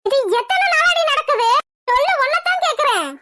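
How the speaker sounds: high-pitched, indignant interrogation; angry demand. Acted. Elder sister discovering secret affair, angry demand.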